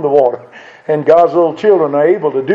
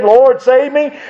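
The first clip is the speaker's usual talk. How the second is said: higher, pleading call to the Lord; mild cry for help; 7 semitones higher, slower than usual. Retold or imitated. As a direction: cry out like a sinking man, but lightly. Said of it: "when you cry out, as Peter did".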